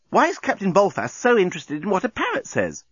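curious woman. A narrator's reading. Nettie asks the corporal.

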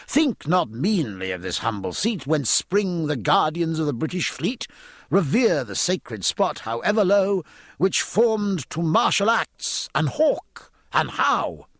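grand, rising poetic recital; stately, drawn-out heroic verse; verse declamation. A narrator's reading. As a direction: declaim the couplets, pitch climbing as they go.

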